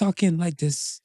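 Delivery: draggy, subdued slow talk; odd drawn-out delivery. Real, not acted. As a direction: stretch it out lazily and quietly. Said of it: "I don't know why I'm talking like this".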